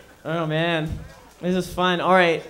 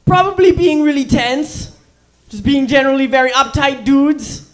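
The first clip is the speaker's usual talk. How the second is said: higher, louder mock German accent; bad German accent, played for laughs; Hitler's German accent; 5 semitones higher, 7 dB louder than usual. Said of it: "Hitler had an awful German accent".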